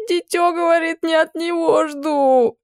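high, tearful complaint; a teen girl, sniffling and sulky. Acted. Upset teen girl complaining to the witch, high and tearful. Girl's voice.

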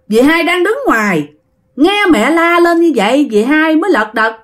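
ordinary delivery, nothing marked

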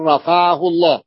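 بل رفعه with the lam merged into a doubled ra; رفعه with a strong doubled r, no lam. Shown as a demonstration. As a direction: say "bar-rafa'ahu". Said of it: "لاحظوا، نحو بل".

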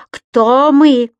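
loud demanding shout. A narrator's reading. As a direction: call it out loud. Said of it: "снова прокричал".

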